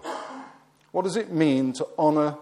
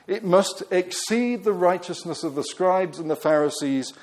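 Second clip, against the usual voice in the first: ordinary delivery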